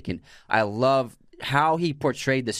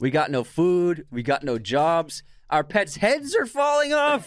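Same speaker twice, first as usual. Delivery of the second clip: Dumb and Dumber quote; 6 semitones higher, slower than usual. Acted. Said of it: "Say it again for the fans".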